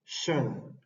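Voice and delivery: "shen" said with a soft sh and short e; shen sound. Shown as a demonstration. Teacher modelling the ending, "shen" with soft sh. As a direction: say "shen" like shun, sh then a quick e. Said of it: "se pronuncian".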